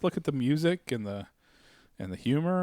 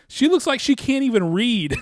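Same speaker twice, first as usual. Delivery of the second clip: higher, louder mock outrage; retold insult; 7 semitones higher, 5 dB louder than usual. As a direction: deliver a cutting put-down, imitating the wife. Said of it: "and she goes".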